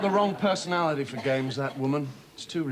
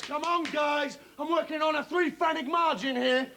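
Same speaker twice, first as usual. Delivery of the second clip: higher, shouted trading floor call; trader shouting; 9 semitones higher than usual. Acted. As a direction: yell it across the dealing room.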